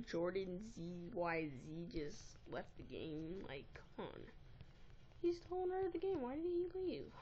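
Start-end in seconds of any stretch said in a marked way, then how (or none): 5.2-7.1 high, loud whine of disbelief; whining, high-pitched frustration; exasperated complaint. Real, not acted. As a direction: protest it in a much higher voice. Complains that game owner left.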